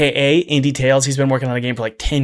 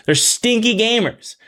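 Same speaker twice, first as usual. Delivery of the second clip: mock whiny; 8 semitones higher than usual. Mocking complaint.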